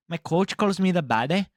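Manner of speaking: playful Liverpool lad impression in a Scouse accent. Mimicking the fighter's brag in a Scouse accent. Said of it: "I can't hold an accent".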